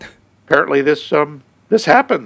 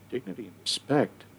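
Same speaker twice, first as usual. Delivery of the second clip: quiet aside; 3 semitones lower, 15 dB quieter than usual. Real, not acted. Quiet echo of quote.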